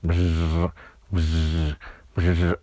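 low buzzing hum; toothbrush buzz. A narrator's reading. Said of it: "the toothbrush suddenly came on and said".

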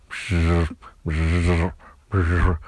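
low buzzing hum; toothbrush buzz. A narrator's reading. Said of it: "The toothbrush said".

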